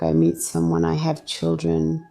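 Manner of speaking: ordinary talk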